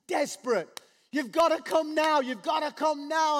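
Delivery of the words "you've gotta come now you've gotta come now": higher and louder, urgent begging; frantic pleading. Retold or imitated. Official begging Jesus to come, frantic. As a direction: plead like your child is dying. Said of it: "the emotion, the passion".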